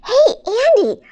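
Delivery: high, loud hail to a friend; excited calling out. Acted. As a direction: grab a friend's attention with a bright idea. Calls friend with new idea.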